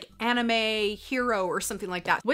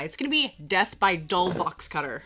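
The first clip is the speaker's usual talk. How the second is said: normal talking voice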